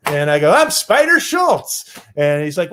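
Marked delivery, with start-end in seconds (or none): 0.5-1.9 high, slow proud intro. Retold or imitated. Introducing himself to kid.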